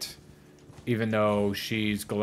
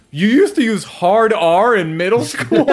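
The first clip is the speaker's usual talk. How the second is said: higher, louder, slower disbelief; mock incredulous; 10 semitones higher, 9 dB louder, slower than usual. Imitating a host stunned by what Linus says. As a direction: act floored by the confession. Voicing shocked interviewer.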